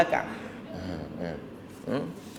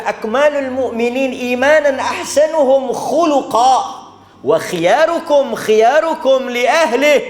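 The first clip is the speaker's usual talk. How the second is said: raised, intoned Arabic quote; hadith recitation; 7 semitones higher, 3 dB louder than usual. Acted. (bacaan hadis bersemangat)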